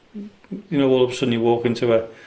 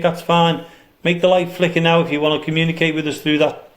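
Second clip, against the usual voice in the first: raised voice, coaxing the unseen; calling out; 4 semitones higher than usual. Real, not acted. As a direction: call out firmly but kindly. Calling out to spirits.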